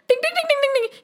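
rapid, very high little bell sounds; high tinkling ding-ding. A narrator's reading. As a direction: tinkle it like stars round a dazed head. Imitates dazed serpent's ringing head.

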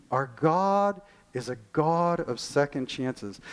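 slow, weighty stress on "Our God"; slow emphatic proclamation. Acted. Preacher to congregation, repeating the key line, slow and emphatic. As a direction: lay out "Our God is a God of second chances" slowly, each word landing. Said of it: "Listen to this".

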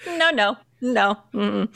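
ordinary delivery

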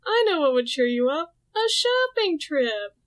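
fake-sweet mean girls. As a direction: coo it like a bully pretending to care. Mean girls fake comforting Veronica.

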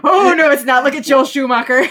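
emphatic no, it's not; loud protest. Real, not acted. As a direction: shout it down in playful outrage. Mock protest about Batman films.

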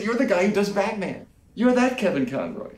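high eager voice; excited. Retold or imitated. Architect excitedly naming the voice actor. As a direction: point him out with glee. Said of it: "He said".

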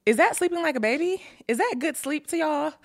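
high, fast disbelieving question; incredulous. Real, not acted. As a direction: ask it like you can't believe it. Incredulous rhetorical questions about baby sleep.

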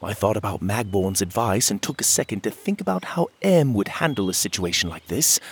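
ordinary delivery

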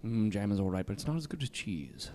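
low, soft suave actor voice; mock-seductive star in a deep murmur; Benedict Cumberbatch. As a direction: ask it low and intimate, as a sultry impression. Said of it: "Watch too much Sherlock".

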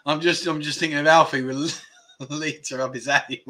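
words squeezed out through laughter; laughing to tears as you speak; helpless laughter. Real, not acted. Streamer picturing a viewer's joke, helpless laughter. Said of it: "Oh, that made me cry".

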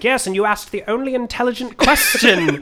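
a hint of Niels Bohr's German accent; mock German accent. Podcaster imitating Bohr greeting Oppenheimer, light German accent. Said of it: "He's doing, like, a accent here".

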